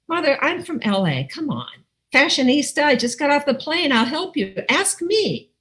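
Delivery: high-pitched, insistent self-promotion; mock indignant plea. Daughter from L A wanting mother's attention, indignantly pleading. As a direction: plead to be asked, pitch leaping up at the end.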